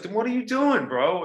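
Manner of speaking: high exasperated "what are you doing"; incredulous challenge. Retold or imitated. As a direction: demand an answer, pitch jumping up. Scolding the flat wrestler.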